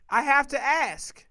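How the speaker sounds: emphatic repeat. Real, not acted. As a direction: drive it home loudly, word by word.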